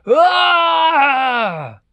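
high, loud drawn-out yell; long acted scream of pain; giant's yell. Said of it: "they hear the giant yell".

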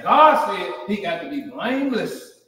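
usual speaking voice

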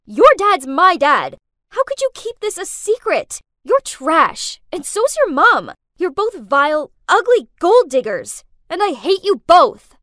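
angry yelling, stretched hard on key words; screamed accusation. A narrator's reading. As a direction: hurl it at her, punching each insult. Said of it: "I screamed at her".

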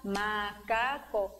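soft, separated syllables in "Macaco". Shown as a demonstration. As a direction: count off "Macaco" gently as ma, ca, co. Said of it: "Você pode riscar".

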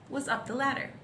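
plain reading voice

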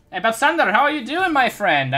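high, loud excited hello; high happy greeting to a friend. Real, not acted. Greeting a game character.

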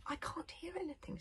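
anxious hushed worry. A narrator's reading. Hiccup straining to hear danger, anxious hush. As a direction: strain to listen, worried and hushed. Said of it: "said Hiccup anxiously".

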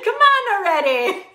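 second stab at Boston, high-pitched; badly faked Boston accent; attempted Boston accent. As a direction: try for Boston and miss. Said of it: "Oh no, that's not Boston".

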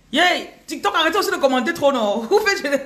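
high, loud, laughing yelp at the comments; excited laughing outburst. Real, not acted. Reader teasing TikTok commenters, high and loud with laughter. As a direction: burst out in delighted laughter.

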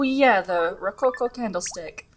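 ordinary talk

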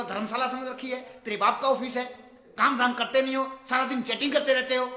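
loud boss tearing into his subordinate; angry scolding. Retold or imitated. Boss yelling at the PN for chatting on the job. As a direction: scold him hard for chatting at work. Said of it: "तो बोला".

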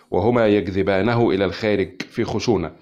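ordinary delivery, nothing marked

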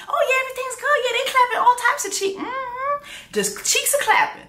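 high, sing-song fake cheer; mock sweet high voice. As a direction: put on a high, too-sweet voice to mock her. Mocking the queen's fake cheer.

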